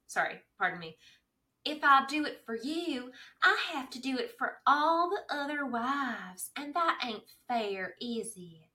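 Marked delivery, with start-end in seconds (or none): 1.6-8.7 Southern-tinged Maisie voice, higher pitch; Maisie impression. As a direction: put on Maisie's twang, coy and stubborn. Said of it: "Finally, we found your impression".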